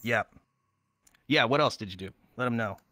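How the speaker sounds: ordinary talk, nothing marked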